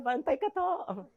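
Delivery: slow, soft Ilonggo singsong warning; slow smiling Ilonggo warning; sweet Ilonggo threat. Retold or imitated. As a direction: smile while you threaten, Ilonggo lilt. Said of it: "mapatay na nakangiti".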